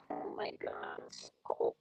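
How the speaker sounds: breathless whisper about the cold; whispered to herself. Real, not acted. Alone in the cold, whispering to herself. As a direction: say it under your breath, shivering.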